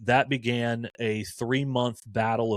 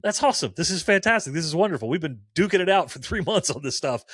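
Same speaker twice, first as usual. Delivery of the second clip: higher, thrilled praise that bubbles over; excited; 6 semitones higher than usual. Retold or imitated. Telling Freddie on the phone his news is wonderful, excited. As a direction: cheer him on like great news just landed. Retold joy at Freddie's decision.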